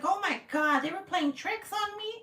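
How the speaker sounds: high-pitched mock wail; hammed-up high complaint; exasperated mom. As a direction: imitate a mom whining about pranks. Retold mom complaining about the kids.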